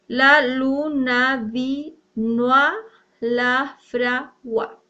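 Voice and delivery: very slow, syllable by syllable; scanning syllables. Shown as a demonstration. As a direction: count each metrical syllable out slowly. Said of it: "aplicamos la sinalefa". (lento, sílaba por sílaba)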